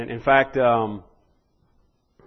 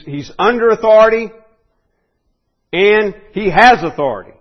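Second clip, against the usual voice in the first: higher and louder, driving the point; forceful emphasis; 6 semitones higher, 8 dB louder than usual. Real, not acted. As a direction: hammer the word authority. Preacher peaks on authority point.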